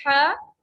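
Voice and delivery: हा pushed out of the throat with pressure; pressed throat sound. Shown as a demonstration. Teacher demonstrates the pressed sound to students, squeezed हा. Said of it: "इस तरीके से दबाव के साथ आवाज आती है".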